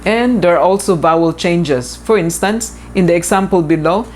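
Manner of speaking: ordinary talk, nothing marked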